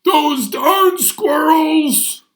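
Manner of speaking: put-on high, loud, slow grumpy old man shout; old man yelling. Grumpy old man yelling at squirrels, loud. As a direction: shake your fist and yell it.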